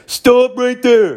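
high, slow shouted stop command; mock a volunteer guard yelling halt; imitating security guy. Mimicking the guard yelling at him to stop.

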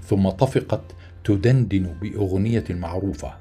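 plain reading voice

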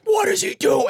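high-pitched mock panic; squeaky, hammed-up alarm at normal volume; flipping out. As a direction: imitate freaked-out friends in a high squeal. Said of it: "they're all flipping out".

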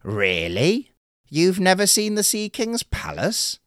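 high, disbelieving turtle; can't believe it, pitch leaps up; incredulous. Acted. Turtle hears fisherman never saw the palace, incredulous and high. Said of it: "asked the little turtle incredulously".

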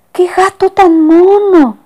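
high, slow cooing lady voice; gushing lady. Acted. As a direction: coo over the cat like a smitten lady. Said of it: "La señora dijo".

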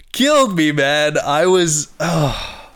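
sudden high, loud cry of anguish; emotional outburst. Real, not acted. Fan overcome recalling the hero's death, loud high outburst. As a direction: burst out, overwhelmed.

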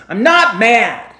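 loud, slow I'm not mad, clearly furious; angry denial. Shown as a demonstration. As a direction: bark the words to betray the anger. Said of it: "do you believe my tone of voice".

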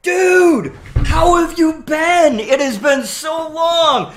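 big slow shouted welcome; overjoyed greeting. Retold or imitated. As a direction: hail a long-lost buddy across the store. Greets a stranger like an old friend.

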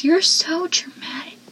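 dry whispered jab; unimpressed mutter; deadpan whisper. Retold or imitated. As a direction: say it flat under the breath. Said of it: "Joey goes".

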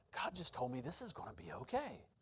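very soft, low private certainty; humble assurance. Retold or imitated. Preacher recalling what God told him, humble assurance. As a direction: hush it, as if quietly told. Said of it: "it wasn't an arrogance".

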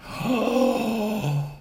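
a sharp, drawn-in shocked gasp; mock-scandalised intake of breath; theatrical gasp of offence; offended gasp. Reacts to being called dumb.

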